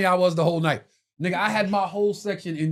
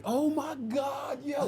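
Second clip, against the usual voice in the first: high, slow, stretched gasp of joy; squealing excitement; 8 semitones higher, 7 dB quieter, much slower than usual. Retold or imitated. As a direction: drag out the delighted shock. Fiancée hears about [name].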